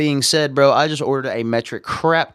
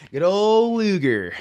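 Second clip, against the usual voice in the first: higher, slower goofy drawl; 9 semitones higher, slower than usual. Pretend. Streamer playing with a silly word in a goofy higher voice. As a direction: savor the silly phrase in a cartoon voice. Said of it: "get a good old Luger".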